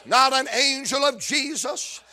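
rhythmic, rising gospel preaching; full-force preaching. Acted. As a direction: preach it to the congregation, building to a shout.

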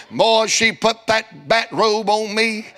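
normal talking voice

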